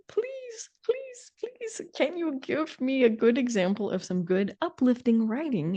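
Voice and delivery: mock pleading. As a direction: beg in a tiny high voice. Pleads for a good example.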